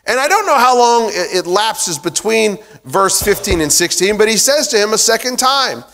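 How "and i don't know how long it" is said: ordinary delivery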